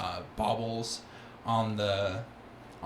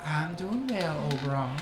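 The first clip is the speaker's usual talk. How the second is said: Calliope replying; 7 semitones higher than usual. Acted.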